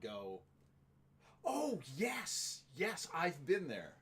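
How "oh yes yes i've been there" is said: high, drawn-out pretend agreement; imitated crowd member chiming in keenly; mock enthusiastic recognition. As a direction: act like an eager listener who relates. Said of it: "have a lot of people go".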